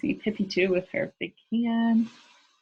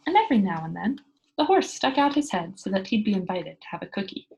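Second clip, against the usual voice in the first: ordinary delivery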